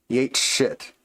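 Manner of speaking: ordinary talk, nothing marked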